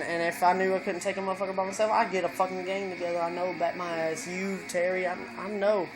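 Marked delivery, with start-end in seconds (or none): none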